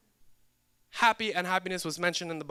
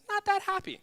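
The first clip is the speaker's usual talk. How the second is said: higher, sing-song not that happy; mock sad punchline; 14 semitones higher than usual. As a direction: toss it up high like a refrain. Repeated punchline, mock high.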